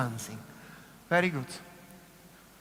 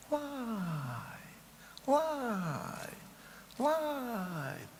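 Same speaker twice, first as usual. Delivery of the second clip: floating light cue; 5 semitones higher, 8 dB quieter, much slower than usual. Shown as a demonstration.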